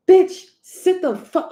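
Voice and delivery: high, loud, drawn-out scolding; exasperated telling-off. Acted. Reader telling off an attention-seeking Leo, exasperated. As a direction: slap down a show-off, pitched high and slow.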